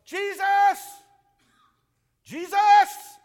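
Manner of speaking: high, loud crying out of Jesus. Shown as a demonstration. Preacher showing the blind man crying over the crowd. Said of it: "No, he was going".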